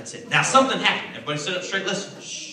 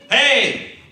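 loud, high hearty holler; hearty husband; 7 semitones higher, 11 dB louder than usual. Acted. Potiphar arriving home to his wife, hearty holler. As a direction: call out like a husband coming home.

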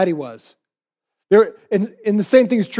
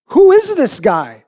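high, louder, slow acted disbelief; incredulous; 7 semitones higher, 6 dB louder, slower than usual. Disciples marveling at who calmed the storm, incredulous. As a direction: stretch the question out in amazement.

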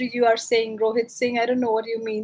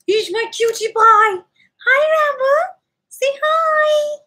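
squeaky pet voice; 10 semitones higher than usual. Real, not acted. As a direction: coo over the dog, all squeaky. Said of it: "I go all squeaky".

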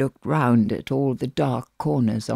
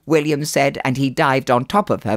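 ordinary delivery

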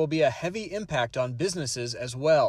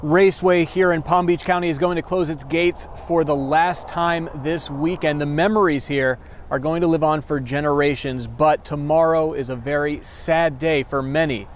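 loud live report from the track; field reporter; 9 dB louder than usual. Acted. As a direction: project like a reporter on location.

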